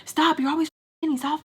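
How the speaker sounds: high-pitched indignant outcry; acted exasperation, pitched well up; retold angry protest. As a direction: push him off with each phrase. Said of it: "I got mad and I pushed him".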